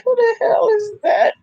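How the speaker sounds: high, half-laughing outburst of disbelief; shocked disbelief. Retold or imitated. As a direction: react like you can't believe who just walked in. Repeats shocked reaction.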